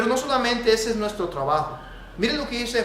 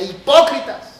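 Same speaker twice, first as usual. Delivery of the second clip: "Hipócritas" shouted, higher and louder; strong tone; 6 semitones higher, 10 dB louder, slower than usual. Shown as a demonstration. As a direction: hurl "Hipócritas" like a rebuke. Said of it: "Y se los decía con un tono fuerte".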